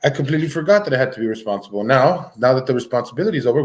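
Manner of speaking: ordinary talk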